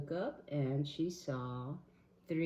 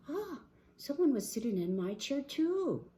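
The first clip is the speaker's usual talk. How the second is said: gasp, then higher dismay; 11 semitones higher, 5 dB louder than usual. Acted. Mama Bear at her chair, high dismay.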